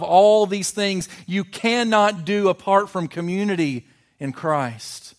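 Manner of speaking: loud, high, driving pulpit voice; preaching at full force. Acted. Pastor pressing the point home to his church, loud full-force preaching. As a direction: hammer it home like a revival preacher.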